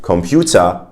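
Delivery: COMPUTER as German "kom-PYOO-ta"; German accent on English COMPUTER. Shown as a demonstration. Teacher shows the German way, German accent. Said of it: "we have a German accent".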